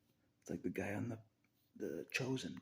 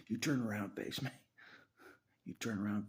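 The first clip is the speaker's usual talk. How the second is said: louder, challenging call to turn around; confrontational demand; 4 semitones higher, 5 dB louder than usual. Real, not acted. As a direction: call him out to his face. Demanding Jesus face him.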